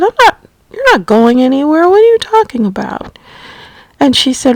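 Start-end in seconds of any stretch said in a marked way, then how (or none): 0.0-3.2 high, quick, startled reassurance; incredulous protest. Retold or imitated. Daughter assuring mother she is not going anywhere, incredulous protest. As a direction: retell it as a quick, disbelieving protest, pitch jumping up.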